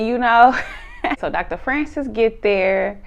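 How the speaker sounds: usual speaking voice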